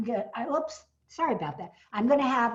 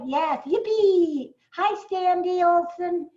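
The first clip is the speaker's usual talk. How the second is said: excited cheer; 7 semitones higher, 5 dB louder, slower than usual. Real, not acted. Greeting a returning fan.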